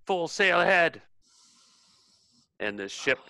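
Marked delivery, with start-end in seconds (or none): 0.1-1.0 high, carrying command; captain yelling to get under way; yelled order. Acted. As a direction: call it out across the deck. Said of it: "he yells out".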